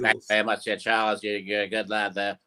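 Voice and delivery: Liverpool cartoon Beatle greeting a fan; cartoon [name]. Saying hello to Charles, Liverpool John voice. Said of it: "impersonation of the four [name] saying hello".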